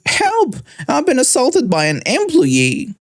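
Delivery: high, piercing mock scream for help; Karen screaming. A narrator's reading. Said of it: "She screamed".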